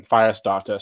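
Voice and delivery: ordinary delivery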